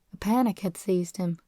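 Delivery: usual speaking voice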